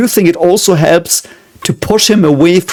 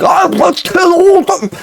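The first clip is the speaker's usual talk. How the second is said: high, drawn-out mock ranting yell; hammed-up dictator shriek, slow and stretched; mock Hitler rant. Showing what Hitler is not saying, hammed-up rant. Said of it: "He's not saying".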